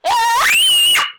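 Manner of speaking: sudden loud high scream. Real, not acted. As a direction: shriek in fright. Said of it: "Sorry for the scream".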